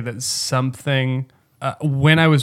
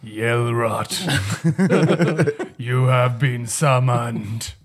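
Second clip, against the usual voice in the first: slow, mock-ominous incantation; summoning voice; 3 semitones lower, much slower than usual. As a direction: call forth the evil genie slowly. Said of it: "That's what happens when he's summoned".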